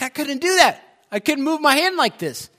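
loud, very high, excited outburst; thrilled disbelief. Retold or imitated. Preacher voicing the healed man showing off his hand, thrilled. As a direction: imitate someone bursting with amazed joy. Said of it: "filled with excitement".

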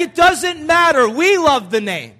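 loud, slow, insistent rebuttal; emphatic protest. Retold or imitated. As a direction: push back loudly, drawing out the words. Retold argument over baby name.

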